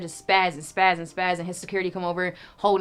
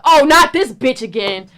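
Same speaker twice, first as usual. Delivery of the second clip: louder and slower, disgusted groan of a shout; mock exasperated yell; 5 semitones higher, 11 dB louder, slower than usual. Teller voicing the rapper seeing her again, slow disgusted yell. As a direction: drag it out like he can't stand her. Angry man voiced in story.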